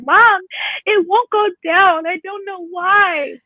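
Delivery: put-on high, slow, whiny kid voice; upset little boy. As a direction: plead like a scared five year old. Crying five-year-old son voiced.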